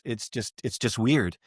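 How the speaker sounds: normal talking voice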